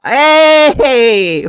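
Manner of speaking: high, loud happy cheer; excited shout. Real, not acted.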